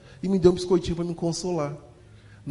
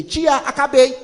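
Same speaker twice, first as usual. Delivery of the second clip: put-on high, loud child's shout; eager child yelling to teacher; kid calling out; 7 semitones higher, 6 dB louder, slower than usual. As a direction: shout it like a proud little kid.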